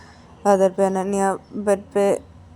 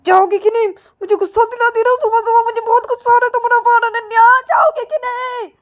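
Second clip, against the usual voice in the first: high, loud, fast furious yell; angry shouting; 11 dB louder than usual. Acted. Payal yells angrily at Ananya to go. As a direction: blast her with anger. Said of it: "चिल्ला क्यों रही हो इतना".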